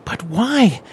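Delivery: a frustrated demand; rising anger. Acted. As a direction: let the anger start to build. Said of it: "his anger building as well".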